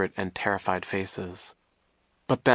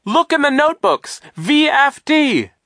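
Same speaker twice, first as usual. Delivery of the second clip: very high, loud desperate scream; high frantic yell slowing on the initials; 10 dB louder than usual. A narrator's reading. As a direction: scream the clue, dragging out the letters. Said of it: "Duncan screamed".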